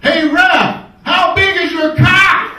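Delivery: put-on high, loud yell at the ref in an Australian accent; crowd yell. Australian fan shouting up at the referee from the stands, loud jeer. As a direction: holler it from the stands, stretched out.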